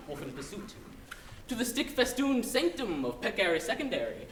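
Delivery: usual speaking voice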